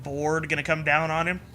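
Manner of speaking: usual speaking voice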